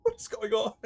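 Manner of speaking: high confused. Real, not acted. Confused at game.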